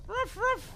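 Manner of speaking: very high yappy ruff ruff; dog bark imitation. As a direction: yap like a small dog. Dog stuck in wall.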